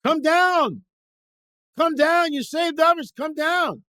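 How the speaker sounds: high, loud jeering shout; crowd yelling. Acted. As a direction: yell it like a mob calling someone out. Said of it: "the crowd is yelling".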